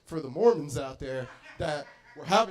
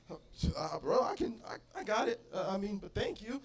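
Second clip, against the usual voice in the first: awkward quiet reply; 7 dB quieter than usual. Acted. His own awkward reply.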